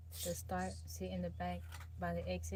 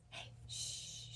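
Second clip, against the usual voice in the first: soft unvoiced hush; quick whispered shush; 11 dB quieter than usual. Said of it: "tell me your voices".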